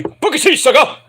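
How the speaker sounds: high, loud Booker T call; catchphrase shout. Retold or imitated. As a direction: belt it out like the announcer himself.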